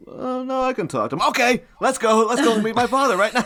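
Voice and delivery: high, gushing mock eagerness; overeager. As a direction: jump in far too keenly. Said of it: "it's a bit overeager".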